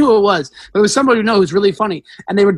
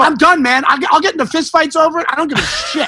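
worked-up, high-pitched comic rant; ranting yell; 7 semitones higher than usual. Acted. Comic tells fellow comics he's fed up, ranting yell. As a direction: rant as if ready to fight. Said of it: "I don't yell like I just did".

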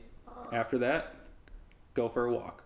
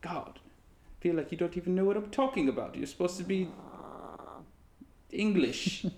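high mock British complaint; British accent; 7 semitones higher than usual. Scolding Leslie for not knowing English food, high British accent. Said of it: "was a British accent".

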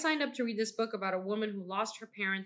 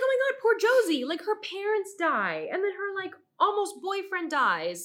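much higher, louder hammed-up wail with a laugh; mock despair; 9 semitones higher, 4 dB louder than usual. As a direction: overplay the pity for poor Josie, half-laughing. Mock-wailing her pity for Josie.

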